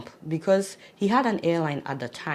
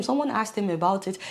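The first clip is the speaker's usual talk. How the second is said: ordinary delivery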